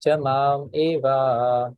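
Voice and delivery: slow, syllable-by-syllable recitation; staccato. Shown as a demonstration. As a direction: recite it slowly, one word at a time. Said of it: "one word at a time first".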